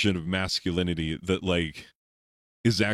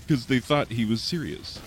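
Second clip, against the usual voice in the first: normal talking voice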